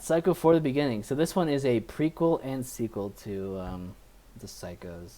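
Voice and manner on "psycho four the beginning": title announcement. Acted. Host announces the film title.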